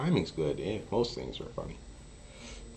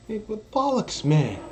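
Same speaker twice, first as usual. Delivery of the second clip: Lincoln quote; 6 dB louder, slower than usual. Retold or imitated. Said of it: "It comes out of Lincoln".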